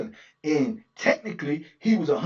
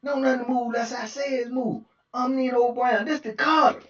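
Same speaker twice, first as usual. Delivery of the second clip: higher, rising to a proud declaration; emphatic boss; 8 semitones higher than usual. Retold or imitated. Booker declaring he runs the building, emphatic. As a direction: claim the building like a kingpin. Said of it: "make it emphatically clear".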